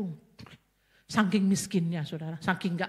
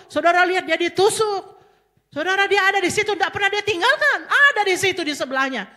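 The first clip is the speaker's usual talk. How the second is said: high, loud, impassioned cry; rising emotional preaching; 9 semitones higher, 7 dB louder than usual. Real, not acted. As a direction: pour out the grief at full force. Preacher describing the crucifixion scene. (khotbah yang meledak penuh emosi)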